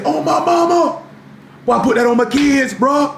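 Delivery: high-pitched, mock over-earnest oath; demonstrative swearing. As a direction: oversell it like someone swearing innocence. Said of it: "the demonstrative stuff, trying to sell it to me".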